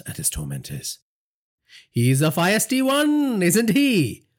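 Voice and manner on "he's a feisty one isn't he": high, slow, amused villain voice; mocking crime boss eyeing his captive; gloating gangster boss. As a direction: savour it like a cruel boss. Villain voice for Naresh.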